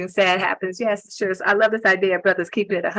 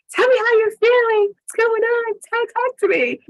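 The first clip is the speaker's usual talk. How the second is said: pleading partner. Retold or imitated. Partner urging a man to talk.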